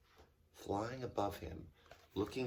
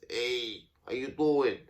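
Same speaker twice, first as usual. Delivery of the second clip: a light New York accent, imitating Guy; lightly voiced New York greeting, like Guy Tozzoli; 4 semitones higher, 8 dB louder, slower than usual. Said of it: "He talked like this".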